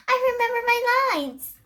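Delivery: high, fast joyful shout. A narrator's reading. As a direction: burst out with relief. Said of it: "Milani shouted".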